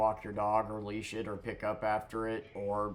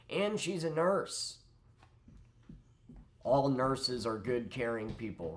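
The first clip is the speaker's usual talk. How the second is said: sarcastic; 4 semitones higher, 3 dB louder than usual. Real, not acted. Sarcastic remark about nurses.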